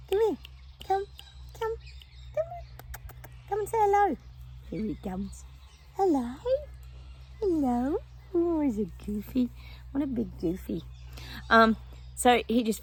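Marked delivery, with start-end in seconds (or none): 0.1-9.5 high coaxing dog talk; pet talk. Real, not acted. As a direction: coax the dog in a high sing-song. Calling the dog over.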